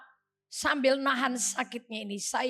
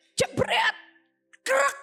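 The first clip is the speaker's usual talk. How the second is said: sharp high-pitched slam noise; sudden high crack of a car door; imitated door slam; 12 semitones higher, much slower than usual. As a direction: mimic a door banging and latching. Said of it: "Pintunya dijebret".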